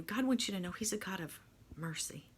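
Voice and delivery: hushed, breathy retelling of the pastor's message; pastor's word to her, whispered. Retold or imitated. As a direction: pass on the holy word in a whisper. Pastor's word retold reverently.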